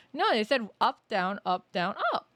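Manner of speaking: loud and rising; excited realization. Real, not acted. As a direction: blurt out the pattern.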